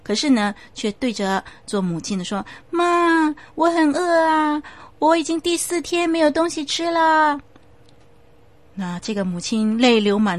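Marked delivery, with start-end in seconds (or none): 2.7-7.4 put-on high, plaintive child voice; hungry ghost child. The ghost child ignores the pastor and begs his mother, high. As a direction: plead with mother for food.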